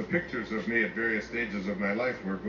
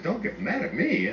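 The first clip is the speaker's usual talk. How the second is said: higher, slow, half-laughing don't get mad; laughing plea; 9 semitones higher than usual. Retold or imitated. Father teasing his daughter about her bra, laughing. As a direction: tease gently through a laugh. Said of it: "I remember looking and said".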